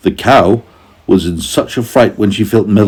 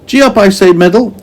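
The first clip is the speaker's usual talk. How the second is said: high urging call, a bit louder; Meddle's voice; 13 semitones higher, 5 dB louder, faster than usual. A narrator's reading. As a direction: push the animal on, high voice. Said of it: "and he swished".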